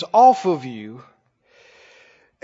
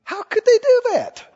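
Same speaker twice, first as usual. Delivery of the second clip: a shocked, superior church voice, pitched way up; high, scandalised mock outrage; mock self-righteous gasp, much higher; 12 semitones higher than usual. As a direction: sneer it from on high like a holier-than-thou saint. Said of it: "Did you hear me?".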